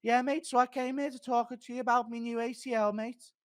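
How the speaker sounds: chirpy British fighter on a podcast, mate; Darren Till. Retold or imitated. Streamer imitates fighter pitching his knee story to Rogan, high chirpy lad voice. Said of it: "Impression of Darren Till".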